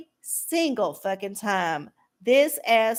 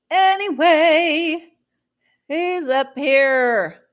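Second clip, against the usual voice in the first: drawn out anyway; 4 semitones higher, 7 dB louder, much slower than usual. Real, not acted. Drawn-out return to chat.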